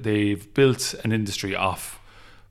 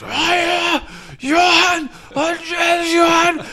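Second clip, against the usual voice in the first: mock yell, much higher and louder; hammed-up shouted greeting, much higher; big shout; 6 dB louder, much slower than usual. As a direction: holler a greeting across a car park. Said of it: "the biggest".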